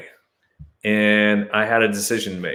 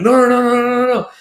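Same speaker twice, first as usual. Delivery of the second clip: much higher and louder, mock panicked no; flustered refusal; 6 dB louder, faster than usual. Searchers refusing the boxers check, mock panicked. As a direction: imitate them backing away in alarm. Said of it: "And they're like".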